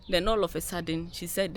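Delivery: usual speaking voice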